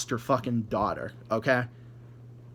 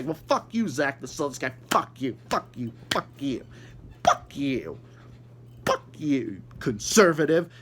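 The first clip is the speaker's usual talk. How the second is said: mock angry commenter. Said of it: "You can say".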